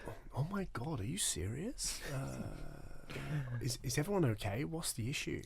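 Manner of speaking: quiet mock Australian girl, concerned; fake-worried Aussie voice; softly imitating an Australian girl; Australian accent. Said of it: "I actually love your Australian accent".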